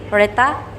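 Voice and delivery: ordinary delivery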